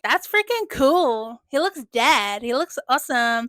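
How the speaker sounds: put-on gleeful kid. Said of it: "the little middle child is like".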